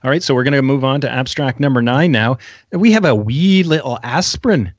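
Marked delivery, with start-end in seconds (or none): 2.7-4.7 light mock Scottish lilt on "wee little"; a playful Scottish twang on "wee"; a touch of Scottish, teasing; Scottish accent. Real, not acted. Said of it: "pulling out the Scottish accent".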